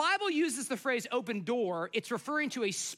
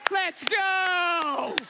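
louder, higher burst of glee; a playful, hammed-up escape call; excited getaway call; 7 semitones higher, 6 dB louder than usual. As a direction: shout it like a getaway. Retelling the jersey getaway.

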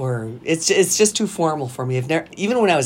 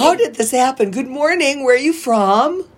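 higher-pitched imitation of a friendly greeter; play-acted small talk, pitched up; mock chatty greeting; 8 semitones higher than usual. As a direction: act out a perky hello to a stranger. Imagines the stranger's chat.

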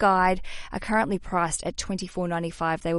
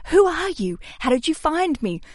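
mock excited surprise; 8 semitones higher, 4 dB louder than usual. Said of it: "And I'm like".

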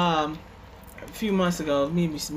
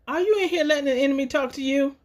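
playful question; 8 semitones higher than usual. Retold or imitated. Wife teasing husband in garage.

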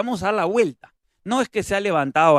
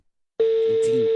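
much higher squeak; squeaky mock voice; 5 dB louder than usual. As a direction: squeak the name. Misma voz aguda. (voz chillona)

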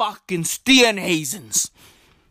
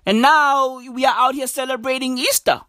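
ordinary talk

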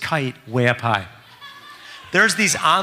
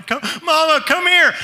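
very high mock child's wail; crying kids; 11 semitones higher, 3 dB louder than usual. Boys crying to Mama in the storm, high wail. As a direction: imitate frightened boys calling for their mother. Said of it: "They're crying".

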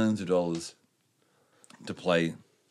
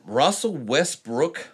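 slow, rising American announcer read of Russell Westbrook; American accent; 5 semitones higher, much slower than usual. Pretend. Podcaster reading NBA news aloud, in a put-on American accent. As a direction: announce the name like a US sportscaster. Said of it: "I'm doing an American one".

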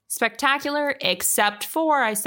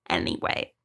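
a comic deep-voiced, quieter Anyway; deep mock anyway; 5 dB quieter than usual. Closing her rant with a deep, quiet Anyway. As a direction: drop way down low and soft on Anyway.

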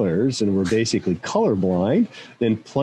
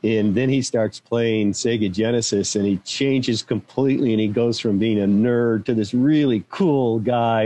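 normal talking voice

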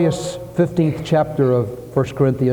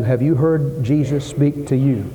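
ordinary talk

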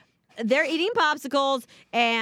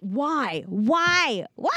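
exasperated why; 2 semitones higher, much slower than usual. Retold or imitated. Retold despair at poop on seat.

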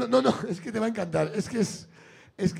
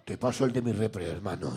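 much lower gruff fake voice, dragging out repre; [name]'s disguised voice; 12 semitones lower, 3 dB quieter than usual. [name] on the phone, faking a voice to dodge callers, very low. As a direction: drop the voice deep to pass as someone else. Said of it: "cambia la voz".

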